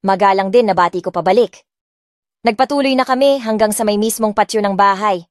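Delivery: plain reading voice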